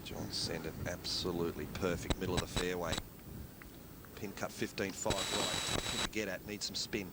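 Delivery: softly called approach shot; hushed golf commentary. Acted. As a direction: keep it hushed, like a golf broadcast. Quiet golf call of an approach.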